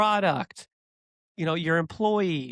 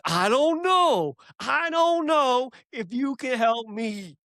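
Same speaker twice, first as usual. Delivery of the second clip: New Jersey gangster; 8 semitones higher than usual. Retold or imitated. Scary gangster instructing the kid at the register, hammed-up Jersey voice. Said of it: "New Jersey guy looking like a gangster".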